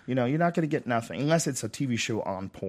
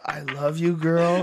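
mocking cheesy lover; 5 semitones higher, 4 dB louder, much slower than usual.

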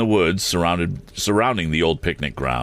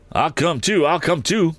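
high-pitched eager cry; 11 semitones higher than usual. A narrator's reading. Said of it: "cried Mister Damon".

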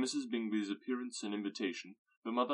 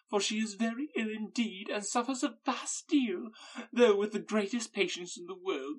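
gushing Mrs Bennet; 10 semitones higher, 7 dB louder than usual. Acted. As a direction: gush over the hosts as a fawning mother.

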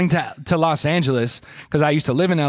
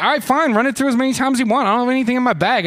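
raised, quick and fed up; irritated; 6 semitones higher, faster than usual. Retold or imitated. Retelling an airport security check, irritated and raised. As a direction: snap back at airport security. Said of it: "I was starting to get irritated".

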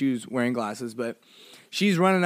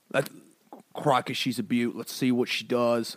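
half-hearted Australian croc-hunter imitation; bad Australian accent; 3 semitones lower, 4 dB quieter than usual. Host admiring the croc, shaky Australian accent. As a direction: try an Australian wildlife host, not quite landing. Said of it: "I can't do it".

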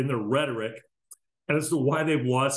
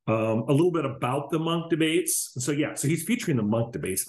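usual speaking voice

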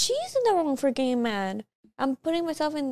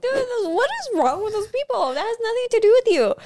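outraged disbelief; 10 semitones higher, 5 dB louder than usual. Real, not acted. Outraged at the friends' reaction.

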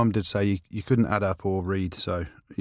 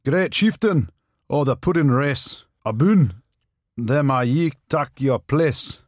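Scottish reading; 3 semitones higher, 4 dB louder, much slower than usual. Pretend. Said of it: "the Scottish version".

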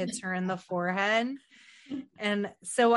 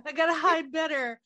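higher, louder hide-and-seek voice; mock playful urgency; 6 semitones higher, 4 dB louder than usual. Killer on the phone to Cindy, mock flustered. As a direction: act out a flustered hider calling instructions.

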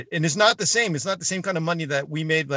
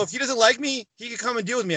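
higher-pitched defiant retort; retold challenge; 7 semitones higher than usual. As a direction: dare him, with the pitch raised.

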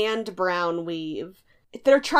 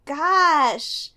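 slow polite surprise; 4 semitones higher, much slower than usual. Retold or imitated. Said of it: "she goes".

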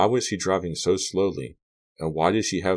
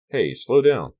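higher, louder alarmed warning; alarmed call; 8 semitones higher, 7 dB louder, slower than usual. Acted. Carrie calling to Mandy to slow the car, alarmed and louder. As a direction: snap it out as the tire skids. Alarmed at her fast driving.

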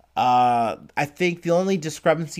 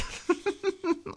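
a squeaky, very high burst of laughter; 5 dB quieter than usual. Real, not acted. Vlogger cracking up at his own joke, squeaky high laugh. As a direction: let out a high-pitched giggle.

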